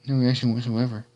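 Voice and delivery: ordinary delivery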